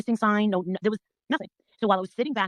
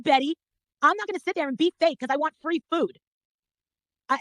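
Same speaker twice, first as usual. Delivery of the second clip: usual speaking voice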